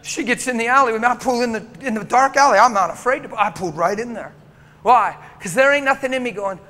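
loud, fast preaching build; fervent pulpit storytelling; preaching at full force. Acted. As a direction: drive the story hard from the pulpit.

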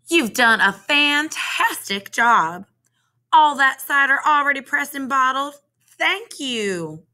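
high-pitched, gushing thanks; storybook farmer's wife, thrilled and grateful; delighted praise. A narrator's reading.

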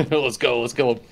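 loud excited rallying cry; pumped up, laughing. Real, not acted. Rallying into the fight.

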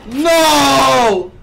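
shout. Real, not acted. Killed by the impostor.

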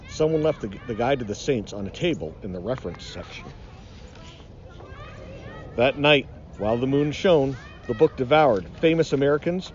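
ordinary delivery, nothing marked

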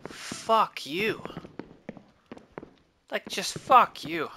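loud, high, furious outburst; angry yell. Real, not acted. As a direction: yell it at the game. Cursing at the game.

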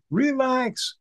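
high, squeaky retold freak-out; mock panic. Retelling the nephew freaking out on the plane, high panic.

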